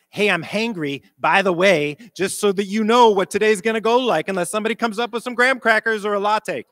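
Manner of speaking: hangry warning. Retold or imitated. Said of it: "actually told your kids".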